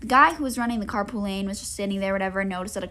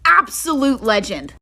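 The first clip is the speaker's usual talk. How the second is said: louder, slower, drawn-out awe; repeating praise for the carpool dad, louder and slower; emphatic admiring praise; 4 semitones higher, 10 dB louder, slower than usual. Real, not acted. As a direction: savor each word in admiration.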